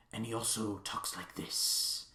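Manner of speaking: put-on muddled Irish-Welsh-Scottish accent, hushed, then shouted at the end; put-on mix of Irish, Welsh and Scottish accents; Robert Downey Junior. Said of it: "he puts on this accent".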